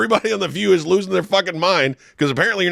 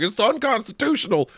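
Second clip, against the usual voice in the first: mocking parrot; 7 semitones higher than usual. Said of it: "Everybody's parroting the same fucking thing".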